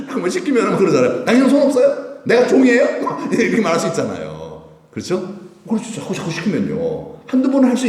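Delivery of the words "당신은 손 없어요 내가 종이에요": loud, high-pitched indignant retort; mock exasperated wife. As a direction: protest like a spouse sick of errands. Acted wife fed up with husband. (참다못한 아내처럼 높게 따지는 말투)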